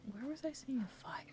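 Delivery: soft unvoiced whisper to herself. Real, not acted. Player whispering to herself while searching the map. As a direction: whisper it under your breath.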